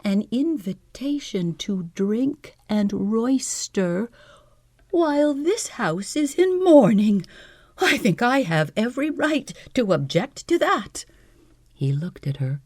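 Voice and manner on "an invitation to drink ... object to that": lightly voiced prim woman, high; a hint of a cold, prim lady objecting; higher, prim female voice, held back; prim widow, quietly. A narrator's reading. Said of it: "she said quietly".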